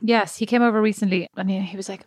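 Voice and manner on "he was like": usual speaking voice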